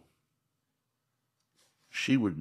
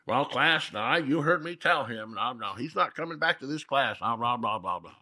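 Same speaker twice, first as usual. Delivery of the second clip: higher-pitched mock teacher taking command; large and in charge; 5 semitones higher than usual. Teacher announcing a student's removal to the class, mock authority. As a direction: imitate a bossy teacher addressing the room. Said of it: "she becomes all large and in charge".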